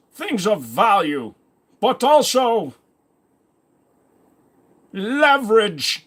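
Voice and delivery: put-on a Russian crime boss drawing out threats in a thick accent; Russian villain accent. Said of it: "voice like that".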